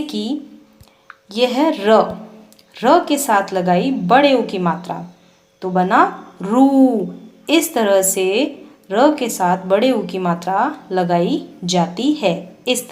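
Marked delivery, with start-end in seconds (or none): 5.6-7.1 रू drawn out slowly; slow long oo. Shown as a demonstration. Teacher showing how र becomes रू, slowly. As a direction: let रू ring long and slow. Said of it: "लंबे समय और ऊंचे स्वर में होता है".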